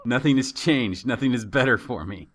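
usual speaking voice

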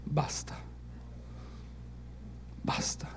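whispered, grave "enough"; hushed divine warning. Acted. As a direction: barely breathe the word, as God's final word. Dios le dice basta a la humanidad. (susurro solemne)

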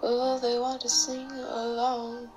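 ordinary talk, nothing marked

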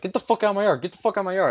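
imitating a high, furious yell; mock the neighbor yelling at him; retold angry yell. Angry neighbor yelling from the shore, imitated high and furious. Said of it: "starts cussing me out".

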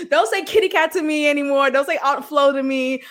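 usual speaking voice

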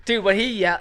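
usual speaking voice

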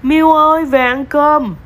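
loud, high call to a pet. A narrator's reading. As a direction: call the cat home from afar. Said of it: "nghe bóng gọi".

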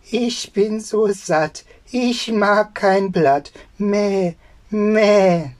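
high, slow sing-song goat; bleating goat. Acted. Goat telling the boy it is full, high and bleating. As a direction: draw it out high, then bleat. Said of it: "Die Ziege antwortete".

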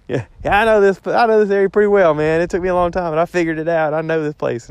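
teasing impression of a know-it-all friend; mock boast. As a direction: parrot his cocky claim back at him. Mimicking buddy's bragging.

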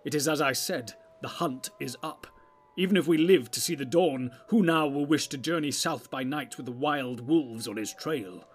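loud, lightly voiced elder; a touch of wizardly urgency; urgent wizard. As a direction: press the warning forcefully.

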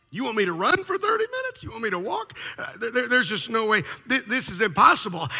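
high, fast disbelief; patient balking at the doctor's orders, pitched high; incredulous protest. Retold or imitated. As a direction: squeak it out, appalled. John protesting run order.

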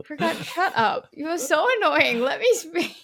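giggling, mock annoyed; half laughing, telling him off; laughing protest. Real, not acted. Laughing, scolding teasing friend.

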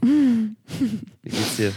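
high, very slow and stretched out; drawn-out high question. Pretend. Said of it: "repeats question drawn out".

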